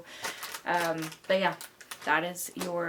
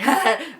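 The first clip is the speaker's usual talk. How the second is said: laugh; 8 semitones higher, 11 dB louder than usual. Real, not acted. Laughs during impression.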